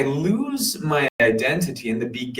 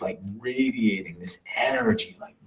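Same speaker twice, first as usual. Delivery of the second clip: whisper; 5 dB quieter than usual. Retold or imitated. Describing a deep meditation state.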